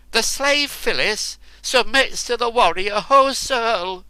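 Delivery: high, loud furious shout. Acted. Enraged woman submitting to the warrior, shouting high. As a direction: spit it out in rage. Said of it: "she shouted".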